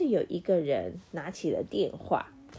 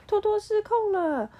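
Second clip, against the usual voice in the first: high, loud alarmed cry; alarmed shout; 5 dB louder than usual. Acted. Clock and phone shouting that Toto is out of control, a high loud alarmed cry. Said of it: "都大叫说".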